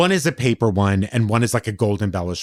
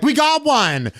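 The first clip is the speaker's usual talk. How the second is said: high, drawn-out crow of triumph; mock glee; 13 semitones higher, slower than usual. Friends showing off a free Hermes bag, mock glee. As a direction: imitate gloating over a silly prize.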